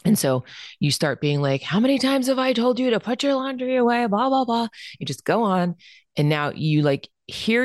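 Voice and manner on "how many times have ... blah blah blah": put-on much higher, sharp nagging rant at the kid; exasperated yelling parent. Parent ranting at child about laundry, exasperated higher scolding. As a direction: scold them like a fed-up mom repeating herself. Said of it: "in the process of yelling at your child".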